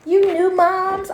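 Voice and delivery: lightly mock the nosy stranger; a hint of a prying stranger; mild nosy lady. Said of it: "she was like".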